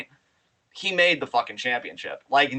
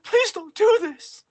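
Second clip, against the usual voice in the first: much higher, slow, pitiful begging; whimpering Heyman; 4 dB louder, slower than usual. Retold or imitated. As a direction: plead like a terrified little man. Heyman pleading, high whimper.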